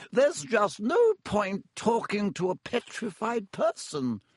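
high, slow, disapproving nurse; lightly voiced stern nurse; brisk matron. Madame Pomfrey, higher and slow.

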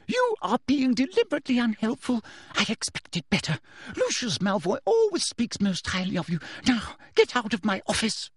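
high, cutting dismissal; cold fury; furious Umbridge. A narrator's reading. Umbridge dismissing Snape, high-pitched and furious.

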